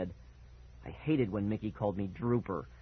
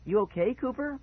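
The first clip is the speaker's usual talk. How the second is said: much higher, questioning mother; concerned mom; 12 semitones higher, 3 dB louder than usual. A narrator's reading. Mom asking coughing son if okay, high concern. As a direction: check on him, pitched up high.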